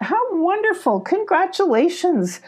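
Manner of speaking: high, pleased exclamation; delighted congratulations. A narrator's reading. Molly congratulates the beavers on their kits, delighted. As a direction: congratulate them with a high lilt.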